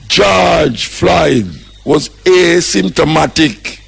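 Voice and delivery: every word of "George Floyd was asymptomatic" drawn out slow and hit hard; slow emphatic announcement. Real, not acted. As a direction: spell out the shocking news slowly, word by word. Reveals shocking news to listeners.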